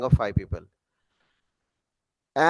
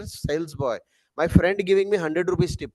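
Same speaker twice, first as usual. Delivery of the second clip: usual speaking voice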